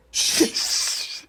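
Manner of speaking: voiceless shush; a mock whispered shush. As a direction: hush the class. Teacher shushing snickering kid.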